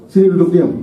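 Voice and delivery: mock parental order. Parent telling a lively child to sit still, mock stern order.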